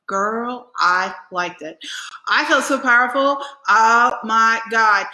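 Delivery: ordinary talk, nothing marked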